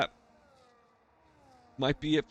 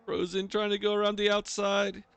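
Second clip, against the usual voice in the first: higher, livelier race call; motorsport commentator; 6 semitones higher than usual. Real, not acted. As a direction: call the overtake with energy.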